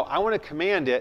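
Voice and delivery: ordinary talk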